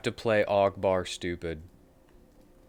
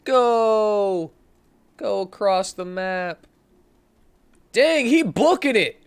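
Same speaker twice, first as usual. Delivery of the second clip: yelling hard and high at the screen; urging the runner on at full voice; excited shouting; 13 semitones higher, 11 dB louder, slower than usual. Real, not acted. Gamer yells at his character to run, excited shouting. Cheering on character during play.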